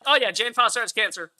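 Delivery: mocking the film's offhand take on the cancer. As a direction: toss it off like it's nothing. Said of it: "they act like".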